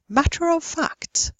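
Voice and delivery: "matter of fact" as "mattuh-rof fact", high; linking R. Shown as a demonstration. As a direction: link "matter" to "of" with an R. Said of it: "they pronounce it there".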